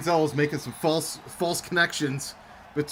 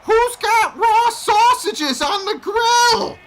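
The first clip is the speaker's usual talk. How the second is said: put-on high, slow, screeching old granny yell; grandma yelling; 10 dB louder, much slower than usual. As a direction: shriek it like an angry grandma across the house. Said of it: "My grandma's yelling".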